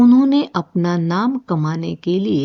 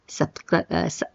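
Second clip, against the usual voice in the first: real stutter; 4 dB quieter than usual. Real, not acted. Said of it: "मैं भी यहाँ पे स्टटर हो गई".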